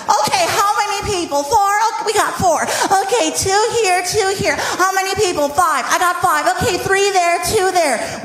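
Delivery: high, businesslike headset lady; high-pitched, over-serious attendant voice; officious ride attendant. Retold or imitated. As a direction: count off riders self-importantly in a high voice. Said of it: "the one who takes her job way too serious".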